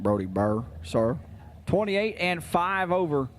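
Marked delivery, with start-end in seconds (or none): 0.0-1.2 put-on deep Southern accent with a respectful sir. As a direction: answer like a polite deep-voiced Southern racer. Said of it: "I see him having a deep southern accent".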